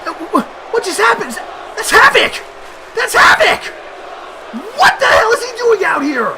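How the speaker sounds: very high, stunned, stammering shout; real squealing commentator shock; shocked yelling. Real, not acted. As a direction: cry out in disbelief at a surprise intruder. Shock at surprise intruder.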